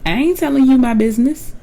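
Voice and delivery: high, quick mock I ain't telling you; playful mock sass; sassy brush-off. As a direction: voice a guarded friend's sassy dodge. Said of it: "the look on Giselle's face said".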